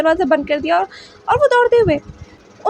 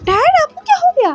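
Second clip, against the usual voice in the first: a son shouting that his parents are bewitched; angry shocked accusation; 8 semitones higher than usual. Acted. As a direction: voice pitched up in furious disbelief. Wing shocked, accusing alpha loudly.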